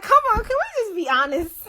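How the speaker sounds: high, laughing through the words; giggly high-pitched plea. Retold or imitated. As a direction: plead while cracking up.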